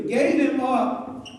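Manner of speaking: Gave him up drawn out slowly for the crowd to repeat; slow call-and-response. Shown as a demonstration. Pastor having the church repeat the phrase, slowly. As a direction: lead them in saying Gave him up slowly. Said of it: "Y'all say this with me".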